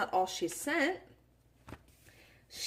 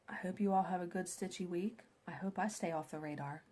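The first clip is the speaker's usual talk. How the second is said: whispering; 5 semitones lower, 9 dB quieter than usual. Real, not acted. Whispered sign-off to viewers.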